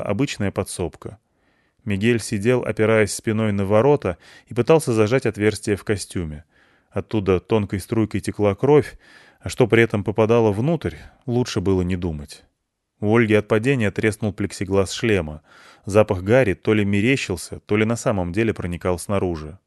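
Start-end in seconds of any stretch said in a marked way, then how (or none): none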